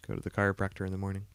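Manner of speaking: usual speaking voice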